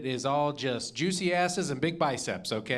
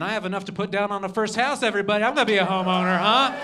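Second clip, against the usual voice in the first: building louder and higher to a shout; rising victory cry; triumphant; 6 semitones higher, 7 dB louder than usual. Real, not acted. Comic closing the set to the crowd: building triumphant shout.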